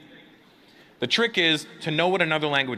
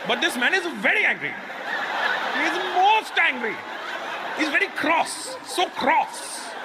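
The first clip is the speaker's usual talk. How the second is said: Indian onlooker; 7 semitones higher than usual. Retold or imitated. Indian onlookers voiced reacting.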